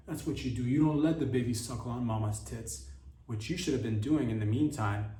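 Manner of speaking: ordinary delivery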